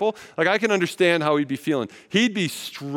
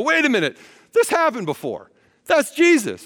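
sudden recognition; 10 semitones higher, 5 dB louder than usual. Acted. Disciples recognize Jesus.

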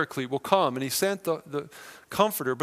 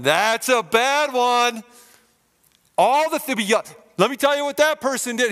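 accuser yelling; 7 semitones higher, 6 dB louder than usual. Acted. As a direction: hurl the accusation from far off. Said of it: "he's gonna be yelling".

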